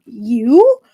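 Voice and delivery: loud rising cry of recognition; startled cry. A narrator's reading. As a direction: cry out in alarmed disbelief. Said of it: "she immediately cried out".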